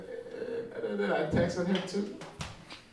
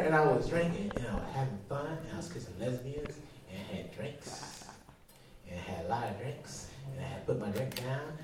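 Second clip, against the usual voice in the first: ordinary talk, nothing marked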